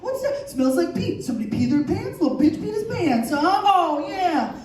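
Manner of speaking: high-pitched, hammed-up taunting rant; over-the-top playground bully takedown; mock bullying tirade. Mock ambush of a bully kid.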